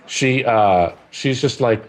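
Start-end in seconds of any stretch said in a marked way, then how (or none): none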